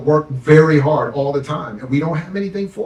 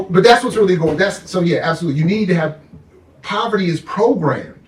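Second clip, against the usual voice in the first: ordinary delivery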